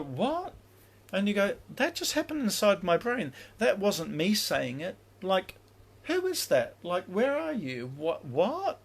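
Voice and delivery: high, bewildered "what?", pitch leaping up; a man stunned by a voice in his own head; startled disbelief. Retold or imitated. Hearing angels inside his brain for the first time, high startled confusion.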